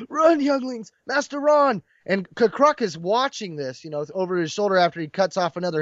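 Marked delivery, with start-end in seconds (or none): 0.1-1.8 higher, crying out; a child crying out as the master is shot, anguished. Retold or imitated. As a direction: call out in grief. Padawan crying out as master falls.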